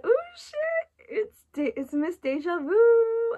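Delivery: squealing high with delight, slowing at the end; thrilled squeal. Retold or imitated. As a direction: shriek it out like a fan whose favourite song just came on. Thrilled her song came on.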